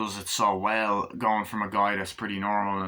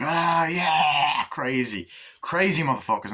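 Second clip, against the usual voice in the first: excited thugs; 5 semitones higher, 4 dB louder than usual. Acted. Thugs whooping in the chase car.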